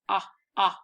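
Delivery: "Ah" as the short O of socks, twice; short vowel demonstrated. Shown as a demonstration. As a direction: isolate each "Ah" with a pause between. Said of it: "think about that word socks".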